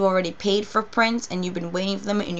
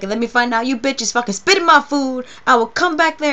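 much higher, louder imitated threat; mock threatening rant; 5 semitones higher, 6 dB louder than usual. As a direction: threaten the staff like the furious friend. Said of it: "throwing all these rants".

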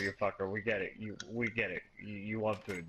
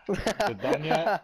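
whiny impatient voice; 5 semitones higher, 7 dB louder than usual. Acted. Mock impatient kid in game.

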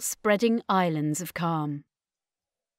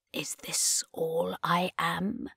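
slow breathless whisper; 7 dB quieter, slower than usual. Acted. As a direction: whisper it slowly, lost. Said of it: "he whispered".

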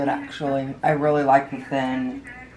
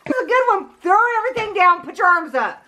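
excited cheering; 4 dB louder than usual. Acted. Cheering coach voice.

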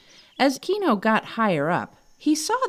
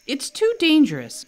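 higher, insistent objection; arguing; 9 semitones higher, 4 dB louder than usual. Acted. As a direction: push back on the reckless plan. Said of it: "argued Ernest".

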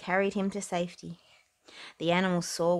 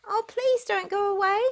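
higher pleading call; calling after them; 13 semitones higher, 4 dB louder than usual. Acted. Percy calling after the ducklings, high pleading call. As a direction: beg them to stay. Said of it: "Percy called".

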